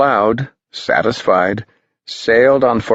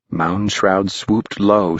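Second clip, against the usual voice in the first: ordinary delivery, nothing marked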